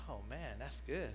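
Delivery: soft murmured Oh, man, that's good; imitating congregation. Congregation imagining free pizza, soft murmur. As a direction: savour it under your breath. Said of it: "A lot of you guys would be going".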